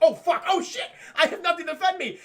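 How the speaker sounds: high, loud, laughing panic; hammed-up panicked cry through laughter; mock panic. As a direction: imitate someone suddenly doomed, shouting. [name] realizes she's defenceless.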